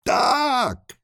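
high, long cry back; shouting back. Acted. Animals with noses in the ground, crying back high. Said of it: "odkrzyknęły zwierzęta".

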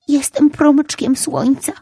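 put-on high, slow little boy's voice; a tiny child announcing himself. A narrator's reading. Little ray boy speaks. (wysoki, powolny głos malca)

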